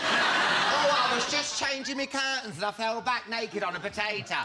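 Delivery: high, laughing fake-innocent patient; hammed-up innocent excuse through laughter; mock patient excuse. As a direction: play the embarrassed patient spinning a lie.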